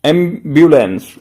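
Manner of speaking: एम्बुलेंस slowly, with the air held back; held-breath pronunciation. Shown as a demonstration. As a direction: hold the air in and say एम्बुलेंस slowly, British style. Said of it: "हवा को रोक के करेंगे". (एम्बुलेंस को हवा रोक के धीरे बोलें)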